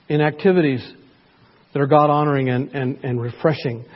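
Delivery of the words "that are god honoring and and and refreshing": ordinary delivery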